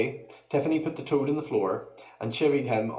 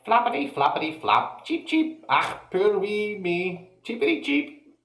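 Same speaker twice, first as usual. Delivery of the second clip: much higher squeaky chirping feagle voice; fake baby bird; 10 semitones higher, slower than usual. Feagle luring the cat, high mock birdy cheeping. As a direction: cheep like a hammed-up wounded chick.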